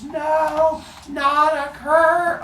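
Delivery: high, slow sing-song wail; mock despair. Cronus wails at his father's curse, high and slow. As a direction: wail it like a hammy soap actor. Son reacts to being cursed.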